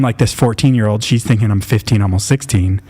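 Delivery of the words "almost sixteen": usual speaking voice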